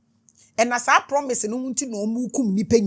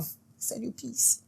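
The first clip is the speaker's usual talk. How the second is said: soft, slow whispered blessing; 11 dB quieter, slower than usual. Acted. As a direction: breathe the words out slowly.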